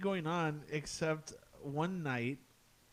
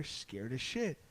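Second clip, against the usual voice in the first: normal talking voice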